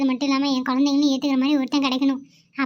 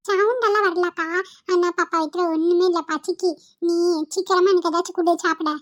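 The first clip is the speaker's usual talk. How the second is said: put-on higher little-boy whine, insistent; hungry child; 4 semitones higher than usual. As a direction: beg your sister for food like a small kid.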